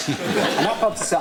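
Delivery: normal talking voice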